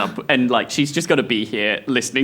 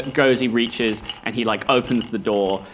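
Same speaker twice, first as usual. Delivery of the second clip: normal talking voice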